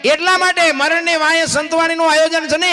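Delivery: high, loud impassioned sermon line; fervent devotional preaching; preaching at full force. Acted. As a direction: press the truth home to listeners. Preacher building emphasis.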